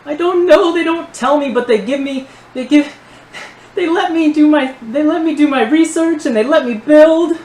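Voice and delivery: high, loud, desperate babble; pleading gnome. Acted. Gnome begging his captors not to kill him, desperate. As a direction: beg in a frantic, stumbling high voice.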